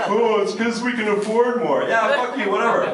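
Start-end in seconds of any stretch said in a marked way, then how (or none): 0.1-1.8 higher smug mock voice; snobby Apple owner. Comedian mocking Apple owners, higher and smug. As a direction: mimic a smug rich fan.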